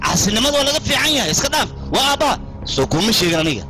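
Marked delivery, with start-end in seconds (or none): 0.0-2.3 raised, high-pitched furious scolding; angry scolding. Acted. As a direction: berate him sharply, voice pitched high. Son scolded for attacking father.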